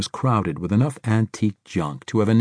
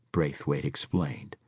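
plain reading voice

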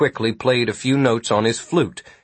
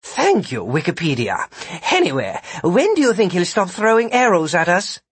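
high character voice, dry thanks then a question; sarcastic Sid; 7 semitones higher than usual. A narrator's reading. Sid answering Vikram behind the force field, sarcastic. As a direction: put on a higher voice for the mock thanks.

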